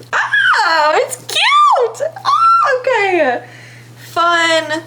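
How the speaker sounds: high, laughing squeal of delight; delighted surprise. Real, not acted. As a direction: squeal at how cute it turned out. Trying on the cut shirt.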